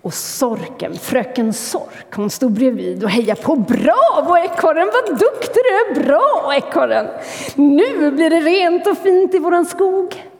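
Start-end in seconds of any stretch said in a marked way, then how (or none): 3.6-10.3 high, loud cheering with a laugh. Acted. Miss Vole cheers squirrel at bin, loud high praise. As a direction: cheer him on with delight.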